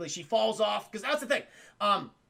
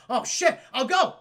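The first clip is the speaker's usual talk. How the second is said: raised, urgent mock alarm; alarmed reaction; 6 semitones higher, 7 dB louder than usual. Mario hearing where they are, loud and alarmed. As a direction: blurt it out higher and louder.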